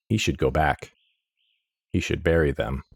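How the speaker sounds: usual speaking voice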